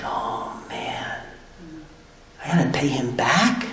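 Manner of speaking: whispered to himself, no voice at all; dismayed whisper. Retold or imitated. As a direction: breathe it out as the penny drops, hushed. Sudden realization, to himself.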